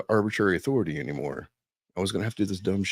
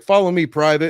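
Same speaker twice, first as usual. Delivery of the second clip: louder, higher sergeant's command; retold military order; 5 semitones higher, 8 dB louder than usual. Drill sergeant ordering a private to follow, commanding. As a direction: give the order firmly and loudly.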